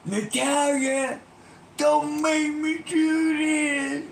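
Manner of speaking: put-on Barry's gun-drawn plea to the possessed girl; desperate shout. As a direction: beg her at the top of your lungs. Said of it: "pulls out his gun and goes".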